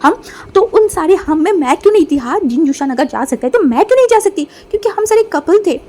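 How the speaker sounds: loud, high jealous outburst; jealous anger. Acted. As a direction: snap it out, hurt at being left out. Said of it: "अब motion ने बोला".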